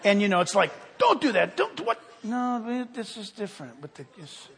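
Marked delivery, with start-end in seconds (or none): 1.0-2.0 high, fretful mock scolding; hammed-up parental nagging; tightly wound parent. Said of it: "parents, they're all really tightly wound". 2.2-4.5 soft, easy giving-in; acted hushed indulgence; indulgent grandparent. Indulgent grandparent contrasted with tense parents.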